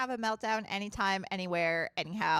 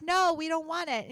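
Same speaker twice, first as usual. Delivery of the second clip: imitating a refusal, higher and louder; mock refusal; 6 semitones higher, 5 dB louder than usual. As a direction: push back in a mock protest. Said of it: "We were like".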